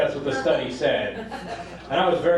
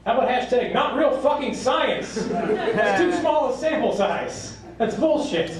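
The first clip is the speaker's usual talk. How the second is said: high-pitched exasperated outburst; hammed-up outrage over shoddy work; indignant rant; 10 semitones higher, slower than usual. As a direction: protest it in a raised pitch. Comic rant at a flawed study.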